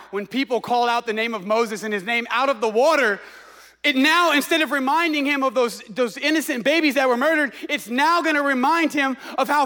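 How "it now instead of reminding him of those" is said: usual speaking voice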